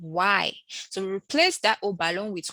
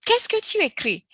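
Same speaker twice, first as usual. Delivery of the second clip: emphatic model question; 13 semitones higher, 5 dB louder than usual. Shown as a demonstration.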